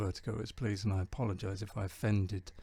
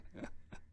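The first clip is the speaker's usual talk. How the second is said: soft, voiceless laugh; real hushed little laugh; quiet chuckle; 12 dB quieter than usual. Real, not acted. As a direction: chuckle softly to yourself at the joke. Amused after stopping the joke.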